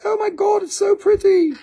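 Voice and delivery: very high mock-gushing falsetto; gushing buyer impression. Buyer seeing the stone in sunlight, gushing falsetto. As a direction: gush in a put-on squeaky voice.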